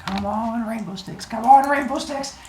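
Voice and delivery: high-pitched eager pleading; excited hoping. Acted. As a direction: chant it hopefully under the breath, rising. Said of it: "they get all excited".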